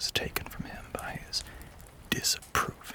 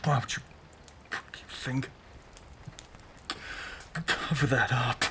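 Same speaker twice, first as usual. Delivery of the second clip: breathy, raised outburst breaking into halting phrases; sudden strained alarm; 3 semitones higher than usual. Acted. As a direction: demand it be covered, urgent and rattled. Alarmed at listener's bleeding finger.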